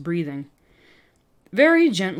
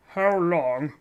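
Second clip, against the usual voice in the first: nasal officer voice. Shown as a demonstration. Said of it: "I'm only doing nasal voices".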